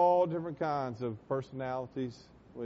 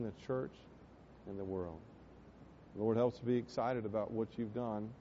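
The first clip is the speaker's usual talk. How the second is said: closing prayer. Acted. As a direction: pray over the church, then sink to a hush. Pastor closes with prayer.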